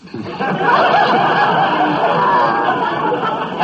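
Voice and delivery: high wheezy chuckle; wheezing cackle. Acted. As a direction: let out a wheezing laugh. Fields cackle.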